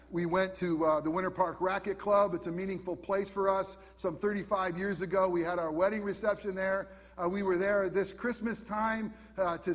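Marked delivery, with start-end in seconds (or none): none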